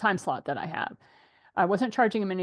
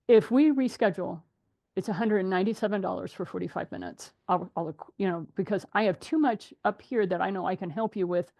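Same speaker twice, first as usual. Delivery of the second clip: normal talking voice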